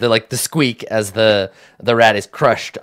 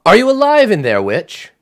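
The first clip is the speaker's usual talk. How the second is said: higher, louder call through a door; raised call; 10 semitones higher, 6 dB louder than usual. Acted. Sister calls to the witch locked inside, raised voice. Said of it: "her voice raises so you can hear her through the door".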